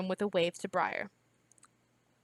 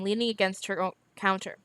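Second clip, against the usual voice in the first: usual speaking voice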